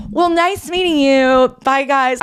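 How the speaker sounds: high, drawn-out mock-polite farewell; awkward goodbye. Guest re-enacting her exit from the actor, awkwardly polite. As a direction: cover embarrassment with a singsong goodbye.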